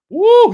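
a high, loud whoop of joy; excited whoop. Real, not acted. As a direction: whoop to pump up the family. Preacher hyping up listeners.

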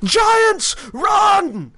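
high, loud warning shout; full-voiced shout of alarm; panicked man yelling. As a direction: yell it out to scatter a crowd. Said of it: "sound of a man yelling".

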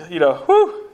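a high, jittery whew blown out; imitating a scared husband's whew; nervous whew. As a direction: puff out a mock-nervous breath. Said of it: "he's scared".

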